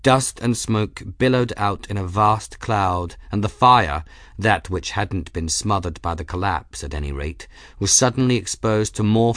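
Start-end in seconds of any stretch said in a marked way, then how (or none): none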